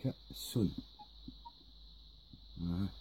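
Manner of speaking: ordinary talk, nothing marked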